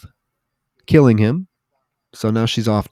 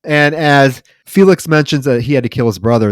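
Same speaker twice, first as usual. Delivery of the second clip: usual speaking voice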